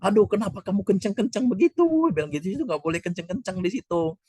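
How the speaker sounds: high, startled mock reproach; alarmed scolding. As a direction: imitate a shocked hush-up. Friend scolds the loud zikir. (teguran kaget dengan suara tinggi)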